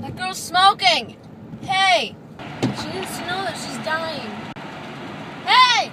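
loud high calls; yelling. Real, not acted. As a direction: shout across the street. Yelling at a smoker outside.

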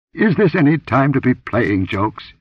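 acidly demanding. Acted. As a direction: scold her for the prank. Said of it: "he demanded acidly".

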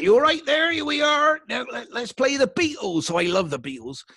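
high, loud British radio presenter patter; hammed-up old-style radio voice. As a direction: belt it out like an over-excited DJ. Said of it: "that's how radio sounded here".

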